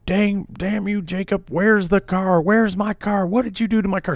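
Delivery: high, shrill-pitched mock tirade from a drunk mom; mom flipping out. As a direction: play the frantic mom yelling about her car.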